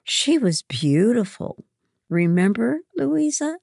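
put-on high, emphatic girlish enthusiasm; gush, then lift into an eager question. A narrator's reading. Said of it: "she gushed".

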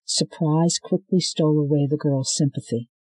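ordinary delivery

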